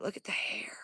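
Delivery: tense, voiceless whisper. Real, not acted. Watching the hairy creature, scared whisper. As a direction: keep it to a breath so it stays still.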